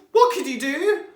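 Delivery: ordinary delivery, nothing marked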